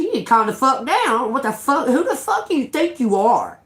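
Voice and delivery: high, heated telling-off; retold angry outburst. Telling the neighbour to calm down, angry retold outburst.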